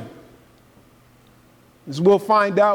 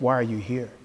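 softer, lower, turned inward with a rising end; asking yourself; 6 semitones lower, 7 dB quieter than usual. Shown as a demonstration. Preacher inviting listeners to ask themselves softly. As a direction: ask it quietly of your own heart. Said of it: "Ask yourself".

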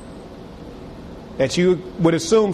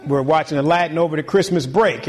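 ordinary talk, nothing marked

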